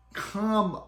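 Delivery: loud, high exasperated plea. Retold or imitated. Fan complaining to the show, exasperated. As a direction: protest at the show, punching it out.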